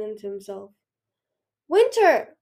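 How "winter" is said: high, loud call from afar; alarmed shout; shouted name. A narrator's reading. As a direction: shout it down from the sky. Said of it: "a voice shouted from the sky".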